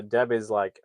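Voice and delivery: usual speaking voice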